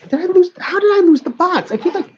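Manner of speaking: high, exasperated cry of disbelief; real exasperation; frustrated exclamation. Real, not acted. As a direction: wail at yourself for losing it. Frustrated lost box.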